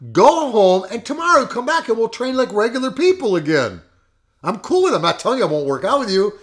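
loud, high, insistent send-off; re-enacted coach's urging. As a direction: urge him to go, pitched well up. Retelling a coach urging quitting.

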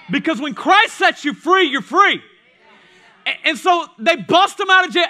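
impassioned preaching at full volume; full-force preaching. Real, not acted. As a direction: proclaim it to the whole congregation.

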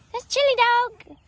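high loud pet voice. Real, not acted. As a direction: sing the name sweetly. Introducing a cow.

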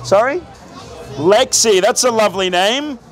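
ordinary talk, nothing marked

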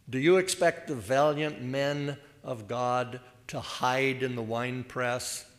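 ordinary talk, nothing marked

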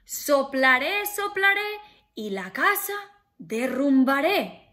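loud booming wolf threat; threatening wolf. Acted. Wolf threatening to blow the pig's house down, loud. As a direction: huff it out to scare them. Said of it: "Y el lobo dijo".

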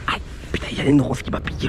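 whispering. Real, not acted. Hiding, pricked by thorns.

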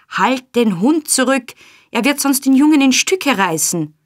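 high, loud, urgent warning; shrieking. A narrator's reading. Nancy fearing the dog will maul the boy, alarmed shriek. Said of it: "kreischte Nancy".

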